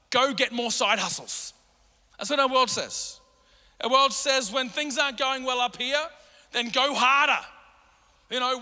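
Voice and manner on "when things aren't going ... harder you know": ordinary talk, nothing marked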